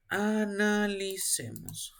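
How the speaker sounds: "Analicemos" stretched syllable by syllable; slow, lingering "Analicemos"; drawn-out prompt. Real, not acted. Teacher nudging a student to work it out, drawn out slowly.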